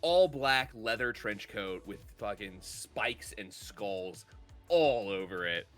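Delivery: ordinary delivery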